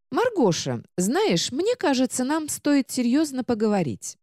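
high book voice, gravely; a solemn little book; serious book voice. Acted. Book announces serious talk.

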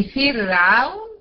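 فرعون slow with a thin R; drawn-out فرعون, R kept thin; light still ra. Shown as a demonstration. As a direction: hold a light R after the i in فرعون. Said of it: "مكسور ترقق".

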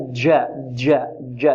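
جا said with a d mixed into the ج, "dja"; tongue tip touches, making each جا sound like "dja"; wrong jeem. Shown as a demonstration. Teacher repeating the mistake to students, d-tinged jeem. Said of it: "خطأ".

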